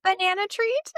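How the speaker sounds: very high, rising pet-calling voice; sing-song offer. Retold or imitated. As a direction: call sweetly to the bunnies. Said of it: "She's like".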